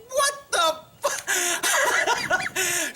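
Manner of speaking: high, drawn-out disbelief breaking into laughter; retold shocked outburst. Driver caught in spotlight by cop, retold shocked outburst. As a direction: react aghast, stretched out and laughing. Startled reaction to cop's stop.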